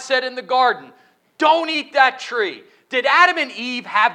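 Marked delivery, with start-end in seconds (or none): 1.4-2.6 slow, louder, higher warning; stern command. Acted. Pastor voices God's warning to Adam and Eve, slow and stern.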